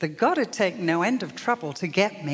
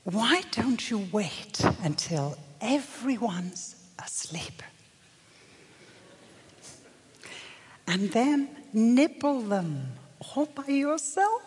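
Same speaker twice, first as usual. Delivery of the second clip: slow, breathy tempter's whisper; tempting whisper; 2 semitones higher, slower than usual. Retold or imitated. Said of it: "the other voice says to me".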